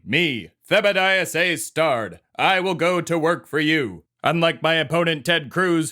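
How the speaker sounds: rally the voters; stump speech. Acted. Character declares his Senate bid to America, as a grandiose stump speech.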